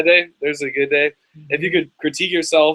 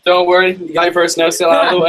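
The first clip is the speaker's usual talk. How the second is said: too loud; 6 semitones higher, 9 dB louder than usual. Acted. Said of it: "Was that really loud".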